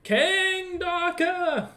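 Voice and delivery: high, very slow, drawn-out call. Acted. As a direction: call the king's name across the hall. DM calls out the king's name.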